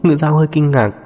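ordinary delivery, nothing marked

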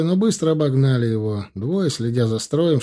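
normal talking voice

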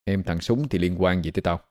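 put-on icy dismissal of the girl; cold, detached. A narrator's reading. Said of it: "nói bằng giọng lạnh nhạt".

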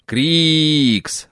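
hissed name, drawn out and falling; familiar hiss. Acted. Angry mechanic's helper sneaking up and hissing his name. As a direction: hiss it from behind his back. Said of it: "знакомое шипение".

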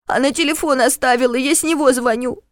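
put-on high, choked child voice; tearful boy. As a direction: speak like a crying little boy.

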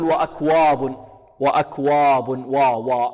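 وأكواب with wa darkened towards waw-aw; emphatic, rounded وا in وأكواب; heavy waw, wrong way. Shown as a demonstration. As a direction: demonstrate the fault, a thick backed وا. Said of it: "يحذر الطالب من تفخيمها".

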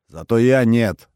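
громче и выше, с нажимом; возразить твёрдо и громко; резкое возражение. A narrator's reading. (решительно, наперекор)

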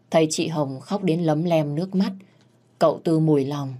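plain reading voice

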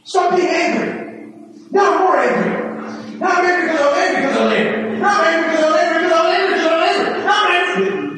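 hammed-up self-feeding rage; spiralling anger. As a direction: work yourself into a frantic comic tantrum. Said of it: "When I get angry because I'm angry".

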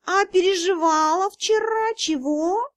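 high, slow musing cat voice; cat spirit asking the girl thoughtfully. Acted. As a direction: ask it slowly, like a pondering cat. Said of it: "задумчиво спросил".

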